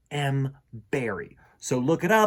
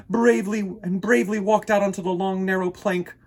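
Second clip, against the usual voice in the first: usual speaking voice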